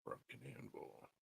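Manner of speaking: barely audible whispered murmur; very soft, absent-minded self-talk; muttering to self. Real, not acted. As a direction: think aloud under your breath. Reading to self while looking something up.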